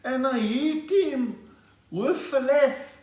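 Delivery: high, slow, drawn-out boxer voice; impression of Gerry Coetzee. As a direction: stretch each phrase out, high and laboured. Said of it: "How did it go, Gary?".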